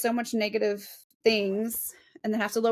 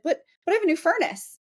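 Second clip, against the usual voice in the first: quick, higher-pitched objection; imitated client protest; 7 semitones higher, faster than usual. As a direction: protest like a mildly indignant seller. Voicing clients protesting about their house.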